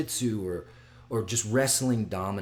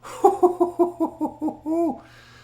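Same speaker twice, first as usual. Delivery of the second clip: high, rapid string of hoots; excited hooting; 9 dB louder than usual. Real, not acted. Fight fan thinking about Saturday, excited hooting. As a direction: hoot high and loud in pulses.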